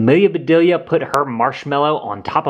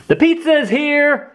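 calling out. Acted. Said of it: "called Rose's father".

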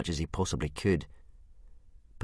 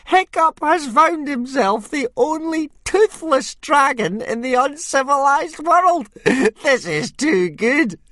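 very high, loud, slow jeering; gloating bully; 11 dB louder than usual. Acted. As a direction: savour the insult, high and loud. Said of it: "cried Snotlout".

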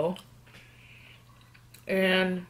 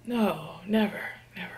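whispered sarcastic denial; 6 dB quieter than usual. Pretend. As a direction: deny it dryly under the breath. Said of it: "Do you use sarcasm a lot?".

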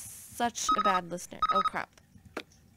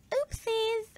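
cutesy oopsies; 12 semitones higher than usual. Real, not acted. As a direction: squeak it out playfully. Cute reaction to failing.

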